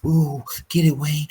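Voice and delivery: a lightly voiced sultry coo; sexy voice. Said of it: "this little sexy voice".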